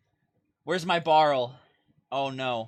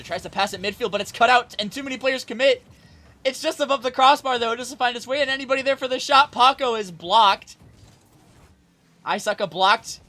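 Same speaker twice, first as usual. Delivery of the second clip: much higher and louder excited caster call; rising excitement; 10 semitones higher, 10 dB louder than usual. Acted. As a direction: ride the scramble at the net, building hype. Hectic goalmouth scramble.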